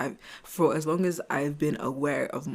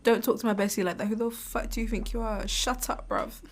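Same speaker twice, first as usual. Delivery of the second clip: sassy defender; 6 semitones higher than usual. Pretend.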